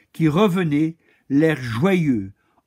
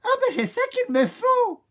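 very high, thrilled squeal; excited cry. Acted. Lady Chameleon squeals as she spots the lion's coat. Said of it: "s'écria dame Caméléon".

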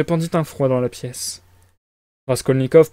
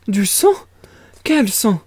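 frightened; 8 semitones higher, 4 dB louder, slower than usual. A narrator's reading. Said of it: "tout effrayée".

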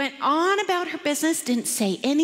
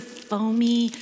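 ordinary delivery

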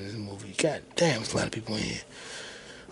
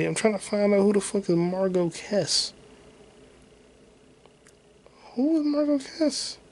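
exasperated; 8 semitones higher than usual. Real, not acted. Frustrated searching, high exasperated voice.

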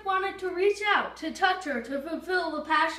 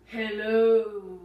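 lightly voiced giant eyeball; 6 semitones lower, 4 dB louder than usual. Giant eyeball greets Pip.

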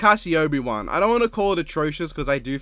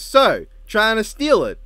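mock accusing drawl; 7 semitones higher, 7 dB louder, slower than usual. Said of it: "Jake goes".

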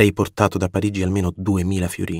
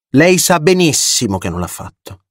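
louder, higher, heated outburst; fiery exclamation; 7 semitones higher, 9 dB louder than usual. A narrator's reading. Polina flares up at the narrator, fiery exclamation. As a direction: fling it back at him with fire. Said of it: "esclamò con fuoco Polina".

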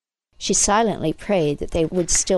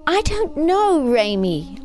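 high burst, falling away wearily; exasperated mother answering her son; 9 semitones higher, slower than usual. A narrator's reading. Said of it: "with exasperation showing in her voice".

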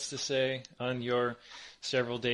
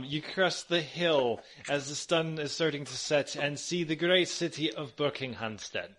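ordinary delivery

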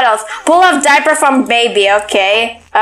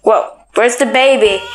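ordinary talk

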